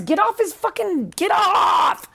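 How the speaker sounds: scolding the aunt for picking on the boy, a high mock shout; mock angry shout. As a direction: shout her off the boy, hammed up.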